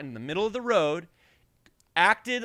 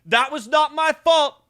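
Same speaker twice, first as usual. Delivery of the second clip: loud, higher insistent denial; defensive driver; 8 semitones higher, 10 dB louder than usual. Retold or imitated. Driver protesting his innocence, a loud, higher voice. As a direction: insist it wasn't you, loudly. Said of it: "He goes".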